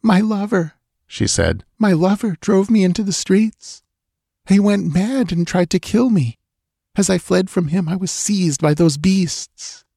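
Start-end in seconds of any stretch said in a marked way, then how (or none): 0.0-0.7 put-on higher voice, anxious young woman pleading; frightened young woman. A narrator's reading. Girl voiced in story. 1.8-9.8 put-on higher voice, anxious young woman pleading; frightened young woman. A narrator's reading. Girl voiced in story.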